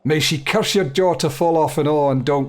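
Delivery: lightly voiced Scottish smith; Scottish accent. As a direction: read the grumbling smith with a Scottish lilt. Smith NPC pleads.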